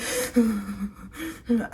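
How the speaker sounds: imitated shuddering breathy sobs; shaky sobbing breaths. Shaken victim climbing into the ambulance, shuddering sobs. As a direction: act out trembling, tearful breathing. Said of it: "I was shaking".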